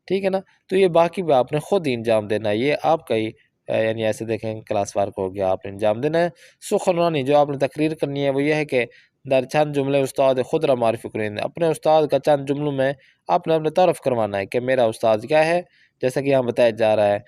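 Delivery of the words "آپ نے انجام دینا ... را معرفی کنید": ordinary talk, nothing marked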